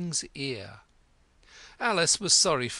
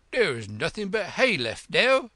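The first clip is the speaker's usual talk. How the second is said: higher, louder messenger voice; messenger character; 6 semitones higher, 7 dB louder than usual. A narrator's reading. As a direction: call it out as the King's messenger. Messenger answers the King.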